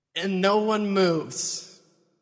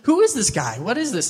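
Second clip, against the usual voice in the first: incredulous asking; 5 semitones higher, 5 dB louder than usual. Acted. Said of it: "he's asking".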